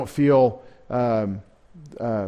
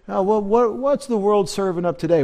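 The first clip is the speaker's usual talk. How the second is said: high, airy voice, raised clearly above his own; sing-song mockery of the uninformed; mock clueless onlooker; 7 semitones higher than usual. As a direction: lampoon a shallow headline reader. Mocking shallow news consumers.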